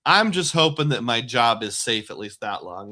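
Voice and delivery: ordinary delivery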